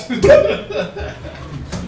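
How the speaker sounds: sudden high laugh. Real, not acted. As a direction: burst out laughing. Laughing at the joke.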